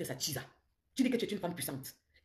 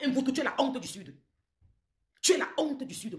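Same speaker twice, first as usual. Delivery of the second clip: shaming shout; 7 semitones higher, 4 dB louder than usual. Real, not acted. Public shaming of rival.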